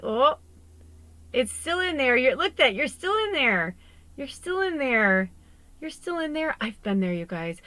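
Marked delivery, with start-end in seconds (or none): 0.0-6.6 higher and louder, amazed at what's inside; thrilled, pointing it out; excited wonder. Real, not acted. Showing viewers the crystal inside, excitedly higher and louder.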